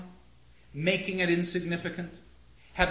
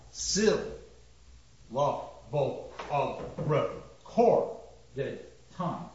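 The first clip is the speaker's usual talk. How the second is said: "syllable of recorded time" broken into slow, lower, separate beats; separate accents; 4 semitones lower, much slower than usual. Shown as a demonstration. Lecturer showing an irregular meter, separate accents. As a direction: give each syllable of "recorded time" its own stress. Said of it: "the first word here that gets an accent".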